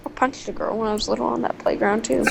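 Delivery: ordinary talk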